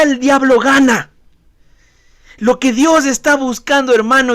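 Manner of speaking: usual speaking voice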